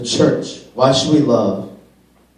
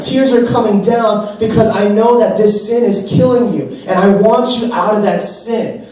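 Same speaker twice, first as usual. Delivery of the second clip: pleading, pitch climbing higher as the tears come; tearful loving rebuke; 8 semitones higher, 4 dB louder than usual. Pretend. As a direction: beg them out of the sin, close to tears. Love voiced pleading over sin.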